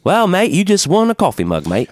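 a playful fake Aussie congratulation; mock Australian. Congratulating the Australian winner in mock Australian accent.

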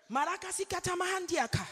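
fluent glossolalia in prayer; speaking in tongues. Real, not acted. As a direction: pray in tongues mid-sermon.

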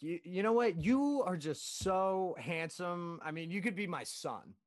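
mock-doting older customer, drawn-out praise; gushing customer. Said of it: "She was like".

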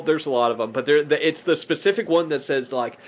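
normal talking voice

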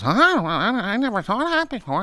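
put-on high, soft, dim amazed old woman; high airy wonder; clueless clerk. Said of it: "And she goes".

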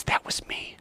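soft whispered quote; voiceless, intimate whisper; hushed reveal. Acted. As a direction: whisper it tenderly. Repeats Jesus's whispered reveal.

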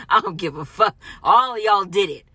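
defiant, full-voiced outburst; loud accusation. Real, not acted. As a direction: jump to a shout and blame them all. Angrily accusing everyone who used her.

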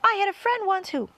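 high, loud rush; eager chatter. A narrator's reading. Centipede eagerly starting a story before interruption.

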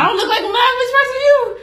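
high, loud, incredulous comeback; indignant protest. Real, not acted. Friend protesting being called not monogamous, loud high indignation.

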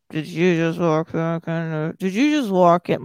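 half-asleep, gummy-mouthed question; groggy impression of a man in bed; gummy sleepy mumble. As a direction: mumble it as if just woken in bed.

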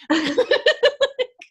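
a high, breaking laugh. Real, not acted. Remembering crying in class, high laugh. Laughs after holding back tears.

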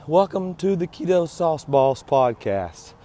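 hoarse, strained, failing voice; voice giving out. Real, not acted. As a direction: push through a worn-out voice. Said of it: "Sorry for my voice".

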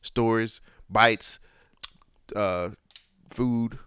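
normal talking voice

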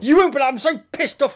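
loud, high-pitched acted outburst; mock anger. Angry man fancying he can fight, loud mock outburst. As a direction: burst out in pretend fury. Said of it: "when they're angry".

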